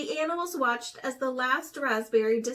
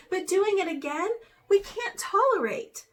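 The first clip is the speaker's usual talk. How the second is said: higher, louder condemnation; stern; 7 semitones higher, 4 dB louder than usual. A narrator's reading. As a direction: lay down the law. Animals condemning Raven.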